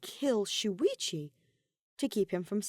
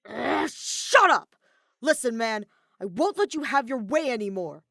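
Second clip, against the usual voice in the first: snapped impatiently; 4 semitones higher, 8 dB louder than usual. A narrator's reading. Kaito snaps at his taunting captor, loud. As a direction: bite the words off, fed up. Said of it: "Kaito snapped impatiently".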